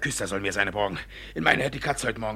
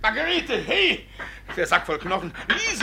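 calling out; 9 semitones higher than usual. Acted. Calling the maids again.

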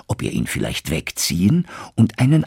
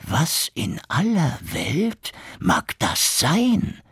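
slow, higher wondering innkeeper; curious innkeeper; 9 semitones higher, slower than usual. Acted. Innkeeper wondering about the guest's sack, slow and curious. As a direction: muse greedily to yourself, slowly. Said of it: "dachte er".

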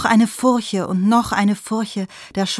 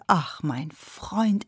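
1 tender sigh; 3 semitones lower, 6 dB quieter, much slower than usual. A narrator's reading. Friend happy for him.

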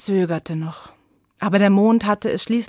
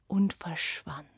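softer hushed whisper; 3 semitones lower, 8 dB quieter than usual. A narrator's reading. Narrator whispering as night falls, hushed. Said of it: "whispered narration night falls".

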